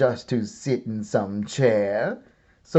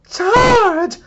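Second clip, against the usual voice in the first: much higher, louder charge cry; dinosaurs yelling; 8 dB louder than usual. A narrator's reading. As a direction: scream it like a war cry. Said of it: "a trio of dinosaurs yelling".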